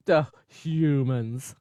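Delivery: weary disdain. Retold or imitated. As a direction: drawl it, unimpressed by humans. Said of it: "looking at them like".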